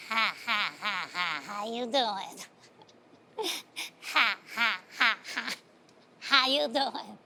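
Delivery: low, hollow cackling greeting; eerie neighbour's low chuckling hello; crazy Miss Stark. Retold or imitated. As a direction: play the odd neighbour laughing at you.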